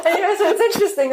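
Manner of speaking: fast, high, still laughing; laughing through words. Real, not acted. Still laughing while explaining to the host, fast and high. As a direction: talk on while the laugh spills over.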